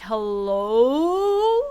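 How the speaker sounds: imitating the character's rising, questioning hello. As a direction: echo the clip's hello as a question. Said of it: "it almost sounded like a question".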